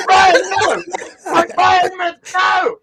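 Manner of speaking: high, loud retold yell; panicked mock shout. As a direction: yell after a friend to stop him, hammed-up. Retells yelling at friend jumping out.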